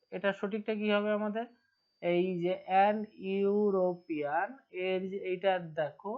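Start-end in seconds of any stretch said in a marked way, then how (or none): 3.4-4.5 European stretched very slowly so the yu start is heard; drawn-out pronunciation. Shown as a demonstration. Teacher stretching the word for students, very slow. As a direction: draw out European to show its opening sound. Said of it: "শুরুটা vowel sound আছে".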